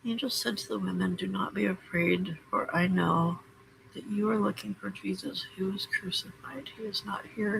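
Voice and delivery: hushed reading of a greeting card; quiet card reading. Real, not acted. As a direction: read the verse gently, almost to yourself. Reads the Easter card aloud.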